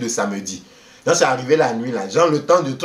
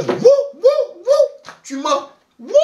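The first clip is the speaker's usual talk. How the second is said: much higher hooting voice; mocking hoots; 4 dB louder, slower than usual. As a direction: hoot at him in disbelief.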